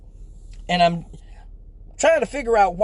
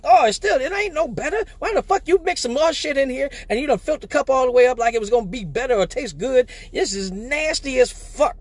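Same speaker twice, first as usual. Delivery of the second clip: mock outrage at the drink; disgusted complaint; 6 semitones higher than usual. Comic griping to AJ about the mixed drink, higher exasperated. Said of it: "I tasted it and I'm like".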